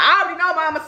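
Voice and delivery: high, knowing mock reply; imitated eager sister. Sister assuring mama she knows, high imitated reply.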